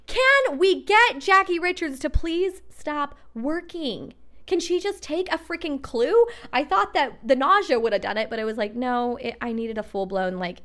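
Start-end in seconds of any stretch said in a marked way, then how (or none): 0.1-6.3 higher, slower, exasperated heavenly plea; a hammed-up fed-up God; God's voice. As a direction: play God begging her to finally stop. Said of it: "God being like".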